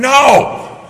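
loud, high, drawn-out NO; sudden forceful shout; emphatic rejection. Real, not acted. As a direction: burst out with a booming denial. Rejects his own mock suggestion.

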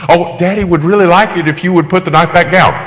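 high, sing-song parody parent; hammed-up high-voiced pleading; mock sweet pleading. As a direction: coax a child in exaggerated sweetness. Parody parent gently pleading with child.

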